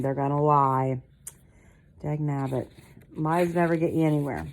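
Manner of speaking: ordinary delivery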